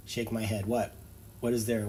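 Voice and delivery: ordinary talk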